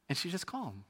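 usual speaking voice

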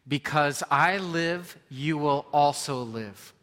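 slow, loud, measured reading; reading aloud together. Shown as a demonstration. Preacher leading the congregation in reading a verse aloud. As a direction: lead the room through the verse slowly. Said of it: "Read it with me".

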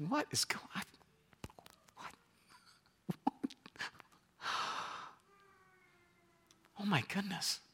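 breathless, whispered amazement; barely able to speak for wonder; stunned whisper. Retold or imitated. Healed man whispers in awe at his restored hand. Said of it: "He's like".

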